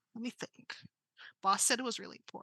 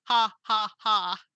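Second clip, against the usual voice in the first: booming robot villain laugh; loud villain laugh; 6 dB louder, slower than usual. Acted. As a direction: laugh loudly like a gloating robot.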